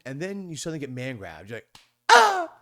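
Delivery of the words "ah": sudden high loud yelp; a startled shriek of fright. Retold or imitated. Grabbed by the huge pimp: loud high yelp.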